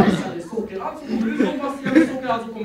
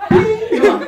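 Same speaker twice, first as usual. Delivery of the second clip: much higher, louder, laughing exclaim; excited cry; 11 semitones higher, 6 dB louder than usual. Retold or imitated. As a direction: burst out in triumph. Mother produces the pill.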